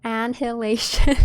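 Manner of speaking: ANNIHILATION as an-hil-ation, H sounded; H pronounced. Shown as a demonstration. Streamer demonstrating her old mistake, H stressed in ANNIHILATION. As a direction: lean on the H in ANNIHILATION. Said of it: "I would say it very".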